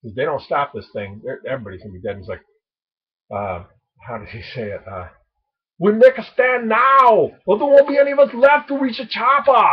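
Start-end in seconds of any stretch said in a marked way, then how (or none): 5.8-9.6 put-on Arnold Schwarzenegger, thick Austrian accent. Vlogger quoting a favorite Predator line, shouted Austrian-accent impression. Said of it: "That's my Arnold Schwarzenegger impression".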